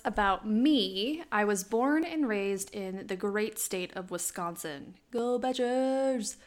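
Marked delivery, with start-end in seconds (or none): 5.1-6.4 slow, drawn-out sports cheer; mock cheer. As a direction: chant it like a fan. Cheer for home team.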